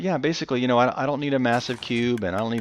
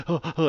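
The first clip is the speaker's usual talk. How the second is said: mock alarmed oh oh; 8 semitones higher, 4 dB louder than usual. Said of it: "It goes".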